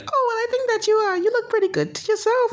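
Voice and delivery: very high falsetto girl impression; put-on girl, far above his own pitch. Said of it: "And she would be like".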